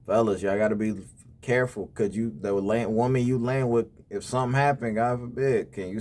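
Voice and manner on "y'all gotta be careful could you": ordinary talk, nothing marked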